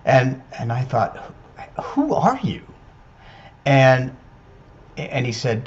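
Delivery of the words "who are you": startled question. Retold or imitated. Hearing voice in empty room.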